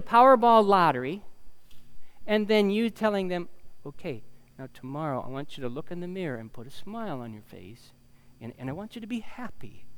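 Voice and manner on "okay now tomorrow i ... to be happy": softly spoken, quick mock instruction; low-key, hushed, patient-sounding coaching; quiet coaxing advice. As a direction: gently tell them what to do. Acted coach instructing the lottery winner.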